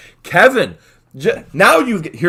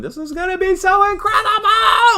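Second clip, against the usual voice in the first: excited manager. Retold or imitated. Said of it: "literally laughing on the phone going".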